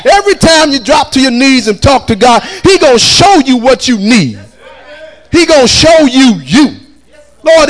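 full-force preaching. Real, not acted. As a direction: preach it out to the congregation, high and strong.

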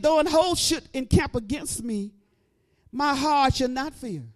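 measured, firm scripture reading; scripture recited. Acted. Preacher declaring the psalm to the church, firm and slow. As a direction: declare the verse slowly.

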